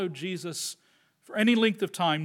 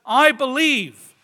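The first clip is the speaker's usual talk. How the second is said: raised, louder, drawn-out cry; 5 semitones higher, 5 dB louder, slower than usual. Acted. Father cries out from deep in his gut, loud and slow. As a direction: call it out slowly from the gut. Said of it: "This father cries out from somewhere deep".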